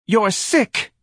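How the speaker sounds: high-pitched wife's shout; shrieked insult; angry shout. A narrator's reading. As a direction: shout it accusingly at your husband. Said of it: "Missus Levy shouted at her husband".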